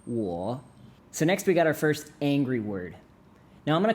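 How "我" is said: 我 low, sinking then lifting at the end; teacher tone. Shown as a demonstration. Correcting the learner's repeat, patient teacher tone. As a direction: dip 我 down and bring it up. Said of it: "Tones matter, remember?".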